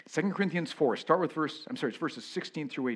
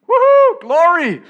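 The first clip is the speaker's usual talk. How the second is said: a big whooping cry of glory, much louder and higher; joyful shout; 23 dB louder, slower than usual. Acted. Preacher acting out a freed sinner's shout, whooping loud. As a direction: shout for joy as the cell door swings open.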